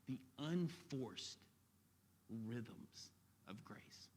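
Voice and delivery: hushed. Real, not acted. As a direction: savour the phrase quietly. Savouring key phrase in sermon.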